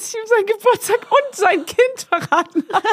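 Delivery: high, whining mock despair; squeaky retold begging; panicked pleading. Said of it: "Und ich so".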